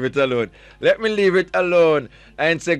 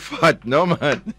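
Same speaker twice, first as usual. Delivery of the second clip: usual speaking voice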